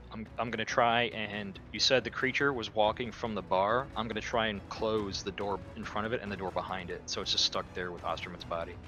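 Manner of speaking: normal talking voice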